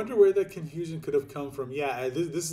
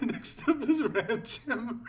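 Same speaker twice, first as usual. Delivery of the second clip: laughing; 6 semitones higher, much slower than usual. Real, not acted. Reading names while laughing.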